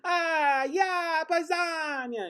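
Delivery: high, slow hammed-up Italian accent; Italian owner. Owner greeting the customer, hammed-up Italian accent. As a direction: play a jolly Italian restaurant owner. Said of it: "the owner was like".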